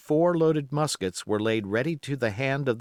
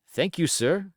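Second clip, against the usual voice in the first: usual speaking voice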